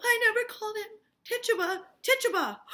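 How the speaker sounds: put-on high, loud panicked girl; frightened denial. Abigail panicking as she blames Tituba, high and loud. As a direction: cry out the name in panic.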